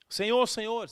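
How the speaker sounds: loud urgent call, pitch up. Retold or imitated. Grieving father clearing the hospital corridor, loud urgent call. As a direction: push through, calling for way.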